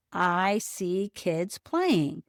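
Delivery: slow, counting each word; saying slowly. Shown as a demonstration. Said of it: "you count the words".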